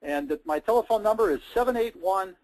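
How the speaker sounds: normal talking voice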